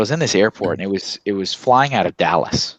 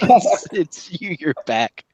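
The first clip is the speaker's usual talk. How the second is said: mock horror; 8 semitones higher, much slower than usual. Horror-movie dread.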